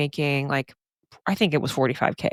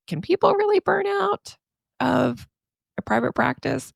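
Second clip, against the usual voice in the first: very high-pitched incredulous questioner; mock disbelief; 12 semitones higher than usual. Podcaster imitating sceptics asking about burnout, mock disbelief. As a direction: imitate a doubter in a much higher voice. Voicing doubtful overseas therapists.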